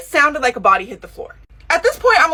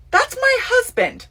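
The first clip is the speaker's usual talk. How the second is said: ordinary talk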